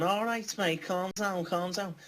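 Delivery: put-on taunting opponent doing a Scouse accent; mock Scouse accent. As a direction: imitate a jokey Scouse lad telling you to calm down. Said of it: "he called me a Scouser".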